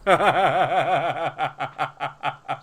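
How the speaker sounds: long rattling run of laughs; real helpless laughter. Real, not acted. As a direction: crack up at your own slip. Cracks up over forgotten name.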